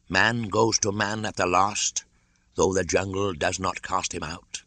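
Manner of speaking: put-on calm old python stating the law; snake voice. Kaa foretells Mowgli's fate.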